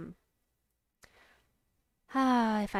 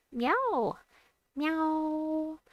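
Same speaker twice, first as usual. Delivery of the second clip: cat meow; 9 semitones higher than usual. Pretend. Greeting a viewer with meows.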